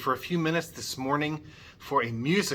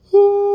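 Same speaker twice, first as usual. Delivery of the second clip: high, loud hoo for them to match; loud high falsetto hoo; high pitch-matching hoo; 14 dB louder than usual. Shown as a demonstration. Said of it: "can you match my pitch".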